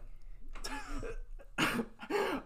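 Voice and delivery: squealing high laugh; high laugh burst. Real, not acted. Laughing at coach memory.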